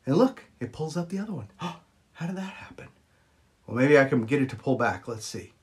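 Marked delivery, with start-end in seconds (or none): 1.5-2.9 gasped, whispered mock wonder; mock astonishment. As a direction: gasp and whisper as if amazed. Mock amazement at own trick.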